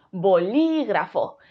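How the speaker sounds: slow "bolígrafo" with long LI; bo-LÍÍ-gra-fo; stress on second syllable. Shown as a demonstration. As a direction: hold and hit LI in "bolígrafo". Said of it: "Si creemos que es li".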